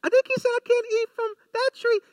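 very high, loud squeaky woman's voice; hammed-up high Eve voice. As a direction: pitch way up and belt a silly Eve. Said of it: "That's my Eve voice".

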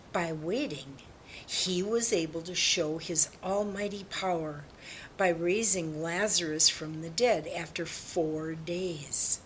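plain reading voice

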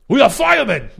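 loud, high impression of a boxing trainer in the corner; hammed-up corner trainer yelling; Teddy Atlas impression. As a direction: bark it like a fired-up cornerman. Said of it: "You guys like a little Teddy Atlas in there?".

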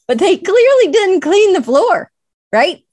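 loud, high, emphatic gripe; exasperated complaint. Real, not acted. Speaker complains about a refurbished bathroom's dirty floor, loud exasperation. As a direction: raise your voice in comic exasperation.

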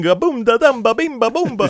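mock Italian accent gibberish. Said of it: "speaking in fake Italian accents".

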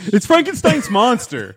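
high, loud, drawn-out correction; mock-indignant shout. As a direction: holler it high like an outraged pedant.

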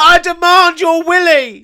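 squeaky character voice. Pretend. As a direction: bellow it like a shrill gremlin. Voicing Timmy Biscuits.

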